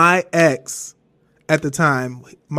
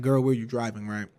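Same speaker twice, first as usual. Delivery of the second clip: lower, softer inner-thought voice; in her head; 5 semitones lower, 8 dB quieter than usual. Retold or imitated. Thinking it at mom who is driving, quiet inner voice. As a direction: drop it down as a private aside. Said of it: "I mean, in my head".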